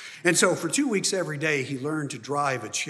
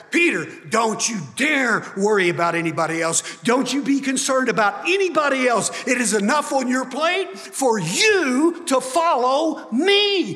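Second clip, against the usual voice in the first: Jesus rebuking Peter; 11 semitones higher, 7 dB louder than usual. Acted.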